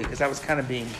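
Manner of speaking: usual speaking voice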